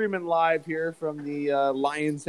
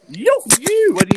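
loud; 11 semitones higher, 10 dB louder than usual. Real, not acted. Calling out greeting to friend.

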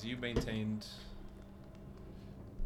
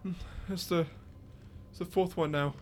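put-on old man; 6 semitones higher, 3 dB louder than usual. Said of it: "you hear the old man go".

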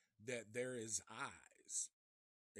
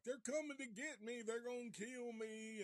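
high, pleading mock voice; Bubba; 9 semitones higher than usual. Bubba telling his mama they're coming, high scared imitation. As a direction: imitate a frightened simple man, high-pitched. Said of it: "And he's like".

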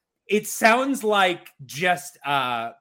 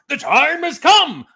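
big booming walrus voice, higher and louder; 11 semitones higher, 10 dB louder than usual. Shown as a demonstration. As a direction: belt it out like a big blustery walrus. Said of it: "I just did that".

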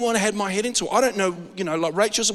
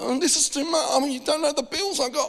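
high, whiny mock complaint about bills; a hammed-up grumble about money; mock complaining giver; 8 semitones higher than usual. Pastor mimicking a pressured giver's high grumble about bills. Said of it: "and like".

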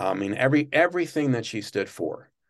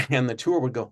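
ordinary talk

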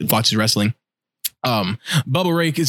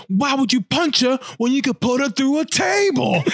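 higher, slow, hammed-up shout; mock wrestler shout; 11 semitones higher than usual. Wrestler proposing the table spot, a slow, higher mock shout. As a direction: bellow it like a wrestling heel. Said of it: "He was like".

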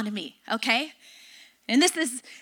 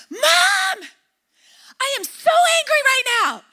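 high, loud, whiny daughter voice, much higher than her own; upset daughter; 9 semitones higher, 4 dB louder than usual. Retold or imitated. Daughter storms in to complain about her sister, high and loud. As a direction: play the outraged child running in to tattle.

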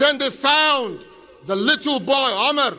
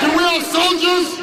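raised, higher call to the crowd; rallying shout; 5 semitones higher, 3 dB louder than usual. Real, not acted. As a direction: work the crowd with a shouted question.

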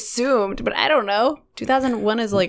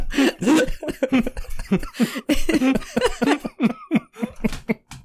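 long high burst of laughter; high laughing; 9 semitones higher than usual. Real, not acted. Big laugh after joke.

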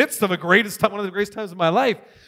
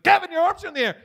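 high, astonished call; imitated excited discovery; amazed onlookers; 10 semitones higher, faster than usual. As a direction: point it out in amazement.

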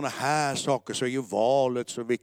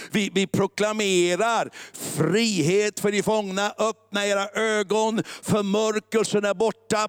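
preaching proclamation; 5 semitones higher, slower than usual. Real, not acted. Preacher proclaiming freedom to the captives, ringing and forceful. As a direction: proclaim it slowly with rising force.

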